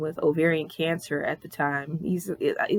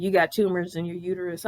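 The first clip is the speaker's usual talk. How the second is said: usual speaking voice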